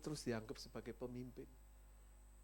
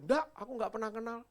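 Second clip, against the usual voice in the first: flustered denial; 7 semitones higher, 8 dB louder than usual. Acted. Peter denying again.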